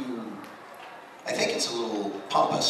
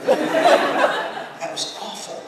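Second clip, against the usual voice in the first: much higher, loud squealing laugh, slow; 9 dB louder, much slower than usual. Real, not acted. Panelist laughing at his own awful impression. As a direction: crack up at your own bad impression.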